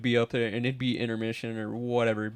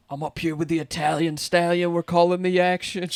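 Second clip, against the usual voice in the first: higher, showy booth call; hyped announcer voice; 5 semitones higher than usual. Retold or imitated. Dad in the booth bragging, higher announcer voice. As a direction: sell it like a big-time announcer. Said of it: "He's like".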